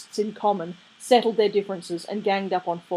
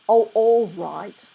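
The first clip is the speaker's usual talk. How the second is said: slow, louder, giving in miserably; wretched; 3 semitones higher, 8 dB louder, much slower than usual. A narrator's reading. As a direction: drag it out like a defeated sulk. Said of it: "said Crowley wretchedly".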